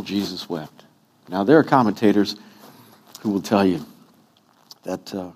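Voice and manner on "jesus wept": low, soft solemn reading. Acted. Preacher quoting scripture, low and solemn. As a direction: read the verse with hushed weight. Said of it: "the shortest verse in the Bible".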